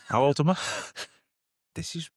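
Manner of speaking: laughing at himself, pitch climbing; real laughter while working out his age. Real, not acted. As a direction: giggle through the question of his own age. Forgetting own age, laughing.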